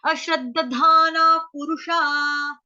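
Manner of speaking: drawn-out model reading of अश्रद्धाना पुरुषा; pronunciation demo. Shown as a demonstration. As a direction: stretch every aa in अश्रद्धाना पुरुषा. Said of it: "फिर धाना".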